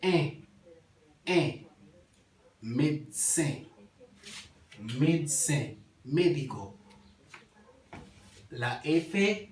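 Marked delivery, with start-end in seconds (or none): 0.0-1.7 "E" as one nasal vowel, "en"; EN en criollo. Shown as a demonstration. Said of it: "La E y la N". 2.6-5.8 "Médecin" as med-sen with a nasal ending; médecin en criollo. Shown as a demonstration. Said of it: "La E y la N".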